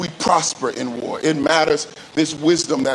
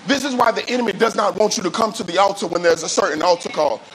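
ordinary delivery

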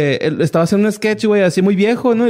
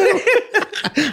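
stifled giggles; 12 semitones higher than usual. Real, not acted. Retelling laughing at night.

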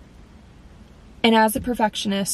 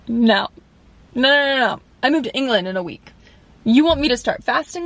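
protesting no; 2 semitones higher than usual. Retold or imitated. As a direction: push back hard, almost pleading. Protesting pastor's fast announcement.

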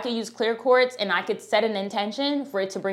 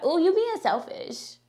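mock sassy scolding; 8 semitones higher than usual. Mimicking people who call goddesses selfish, sassy. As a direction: tease them like a judgy friend calling you out. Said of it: "Have you ever heard that?".

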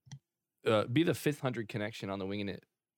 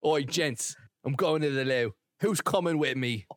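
higher, louder English lad call; English geezer accent; 4 semitones higher, 5 dB louder than usual. Pretend. Calling gents along to the loo, loud English accent. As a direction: holler like a mock English geezer. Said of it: "that sound like Jason Statham".

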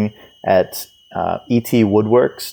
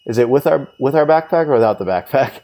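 lightly mocking, higher-pitched question; mimicked eager questioner; 6 semitones higher than usual. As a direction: imitate that guy asking too much. Voicing the annoying question-asker.

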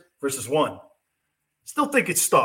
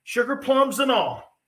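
usual speaking voice

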